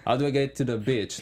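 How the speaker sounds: "beach" with a short i, like "bitch"; quick question, shorten "beach" to an ih vowel; mispronounced BEACH. Retold or imitated. Actor asking directions in L A, mispronounced "beach". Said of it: "small mistake like that".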